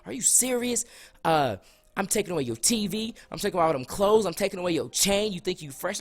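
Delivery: high-pitched imitation of an irate mom; cussing out. Turned-up mom punishing her son, cussing him out. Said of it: "She's cussing me out".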